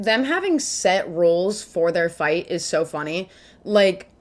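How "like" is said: ordinary delivery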